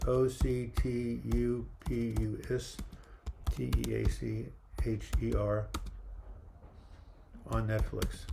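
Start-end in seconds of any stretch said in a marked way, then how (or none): 0.1-5.7 slow, each letter separate: O C T U P U S; spelled out letter by letter. Shown as a demonstration. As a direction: spell out the title one letter at a time. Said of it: "It's called My Octopus".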